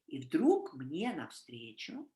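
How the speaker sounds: slow, low and soft, "огромный, страшный" drawn out; напряжённое повествование; dramatic storytelling. Shown as a demonstration. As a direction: tell it like a scary tale. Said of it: "in Russian I can say".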